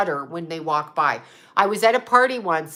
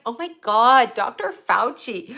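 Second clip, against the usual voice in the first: gushing fan voice, pitched up; mock her swooning over the doctor; mocking Julia Roberts; 3 semitones higher than usual. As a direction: starstruck fangirl parody. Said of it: "She's like".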